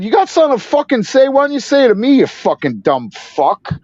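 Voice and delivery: high, fast start slowing into the final insult; retold angry challenge. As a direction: square up to the hecklers, acted out. Retold confrontation with hecklers.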